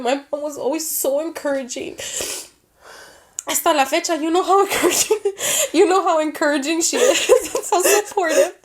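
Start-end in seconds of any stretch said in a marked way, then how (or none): 3.5-8.5 laughing while she talks; real laughter bubbling up. Real, not acted. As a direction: chuckle through praise of mom. Laughing about her mom.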